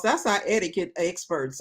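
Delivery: ordinary talk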